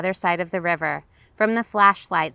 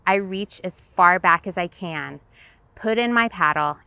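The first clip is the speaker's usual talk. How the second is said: usual speaking voice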